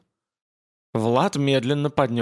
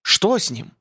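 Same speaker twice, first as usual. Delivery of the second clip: sharp alarmed cry, pitched up; 6 semitones higher than usual. Acted. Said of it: "с криком".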